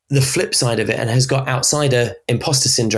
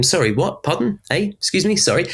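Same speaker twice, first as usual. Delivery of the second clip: higher, quick puzzled queries; uncomprehending listeners; 7 semitones higher than usual. Acted. Everyone questioning a newcomer with an accent, puzzled and higher. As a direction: fire off each query as if you didn't catch it. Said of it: "everyone is saying to them".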